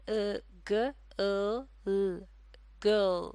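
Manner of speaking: गर्ल split into its sounds, then blended; sounding out girl. Shown as a demonstration. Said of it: "ये तीनों आवाज़ें मिलकर".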